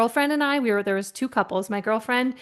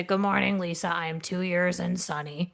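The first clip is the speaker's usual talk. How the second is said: gloomy coworker; 4 semitones lower, 5 dB quieter than usual. Retold or imitated. Paul's reply first time.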